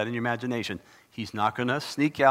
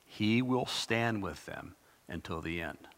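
whisper; 3 semitones lower, 7 dB quieter, slower than usual. Real, not acted. Whispered promise after rules phrase.

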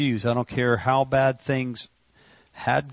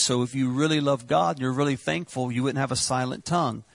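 ordinary talk, nothing marked

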